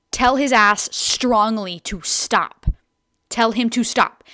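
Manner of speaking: slow, loud and emphatic; firm advice. Retold or imitated. Family member advising her to shut him down, firm. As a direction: lay down each word as an order.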